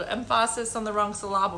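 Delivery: "emphasis" as em-FASS-is, "syllable" as sil-AH-bul; misplaced stress. Retold or imitated. Explaining the joke, "emphasis" and "syllable" stressed on the middle. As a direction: put the beat mid-word in "emphasis" and "syllable". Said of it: "It's the wrong emphasis on the wrong syllable".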